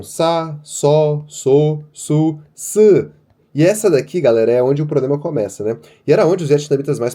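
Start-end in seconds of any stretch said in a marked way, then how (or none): 0.0-3.1 sa, so, su, se each said clearly; reading syllables aloud. Shown as a demonstration. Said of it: "Então". (sílabas com S bem articuladas)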